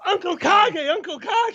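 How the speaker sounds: very high call-and-response impression; high-pitched teasing impersonation; Kage impersonation. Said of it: "our favorite impersonation of Kage".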